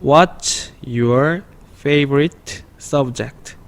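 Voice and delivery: What's your favorite subject read very slowly, each word apart; slow read-along before the linked version; slow separate words. Shown as a demonstration. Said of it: "조금 빠르게 붙여 읽으면".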